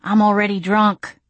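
plain reading voice

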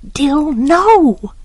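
high, drawn-out no; alarmed protest. A narrator's reading. Scout stopping Dill, alarmed protest. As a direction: object in alarm, pitch leaping up.

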